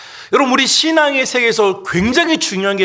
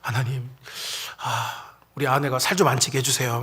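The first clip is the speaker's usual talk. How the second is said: low, soft mock prayer with a sigh; praying husband; 6 semitones lower, 8 dB quieter than usual. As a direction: mumble a reluctant husband's prayer, low and quiet. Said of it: "기도하는 남편들이 막".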